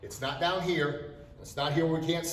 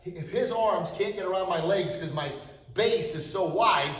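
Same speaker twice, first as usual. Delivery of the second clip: usual speaking voice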